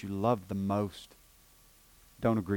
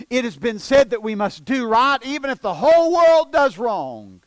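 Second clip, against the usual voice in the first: much higher and louder, ringing preacher's cry; pulpit shout; 10 semitones higher, 11 dB louder than usual. Acted. Preacher proclaiming to the congregation, ringing pulpit shout. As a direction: hit the peak of the sermon.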